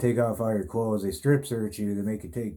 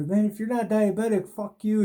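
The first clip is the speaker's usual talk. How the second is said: much higher, cartoonish drunk whine; mock drunk guy; 12 semitones higher, 4 dB louder than usual. Drunk inmate admits he's just hungry to the cops, high hammed-up whine. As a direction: ham up a pushy drunk demanding food.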